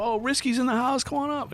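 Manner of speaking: high-pitched stage call; retold hyped greeting; excited welcome. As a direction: imitate a performer inviting a guest up. Band welcomes him on stage.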